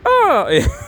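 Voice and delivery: high-pitched, mock oblivious "oh". Man replying to her hint, playing oblivious. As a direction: imitate someone missing the hint. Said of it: "not really taking the hint".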